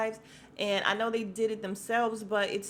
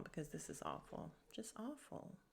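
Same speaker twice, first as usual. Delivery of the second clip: very soft, trailing away; subdued dismay; 2 semitones higher, 16 dB quieter than usual. Real, not acted. Moving on from awful news of a death, hushed dismay. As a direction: let it sink almost to a whisper.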